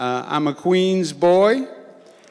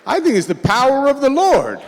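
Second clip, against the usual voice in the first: mock bewilderment; 9 semitones higher than usual. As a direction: play up the puzzlement for laughs. Governor joking about pastor's youth.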